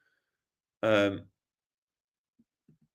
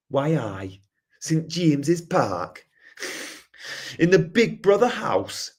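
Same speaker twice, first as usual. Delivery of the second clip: slow, higher Newcastle voice; Geordie accent; 6 semitones higher, slower than usual. Shown as a demonstration. Streamer showing off Geordie with Big Brother line, Geordie accent. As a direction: mimic a drawn-out Geordie announcer. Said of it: "people warm to the Geordie accent".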